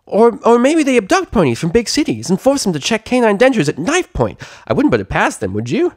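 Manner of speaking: very high, fast, bouncy chatterbox; high giddy pony gabbing; bubbly pony chatter. Acted. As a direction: gush it out without breathing. Pinkie Pie voice.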